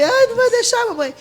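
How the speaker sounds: retold wailing, pitched well up; imitated desperate crying. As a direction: act out a tearful plea to mamãe. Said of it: "começou a chorar desesperadamente".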